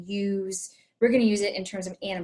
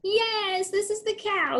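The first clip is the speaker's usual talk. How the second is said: excited praise; 11 semitones higher than usual. Shown as a demonstration. Praising correct answer.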